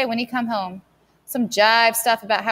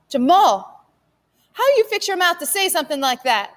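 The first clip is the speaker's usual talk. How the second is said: high, loud stern rebuke; a wounded mother scolding; mother scolding her son; hurt scolding; 8 semitones higher, 3 dB louder than usual. Acted.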